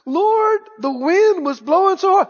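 pleading, self-pitying excuse; hammed-up whine to the Lord; whining excuse. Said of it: "He could have gone".

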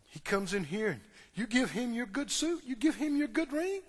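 soft indignant complaint, rising in pitch; aggrieved protest. Acted. Older son protesting to father about suit and ring, aggrieved. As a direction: climb into wounded disbelief.

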